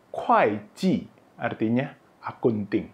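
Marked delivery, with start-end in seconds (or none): 0.1-1.0 kuai ji as 'kwhy jee', both falling; kuai reading. Shown as a demonstration. Teacher showing the exception, kuai ji said 'kwhy jee'. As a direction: open kuai with a k, two falling tones. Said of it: "bacanya jadi".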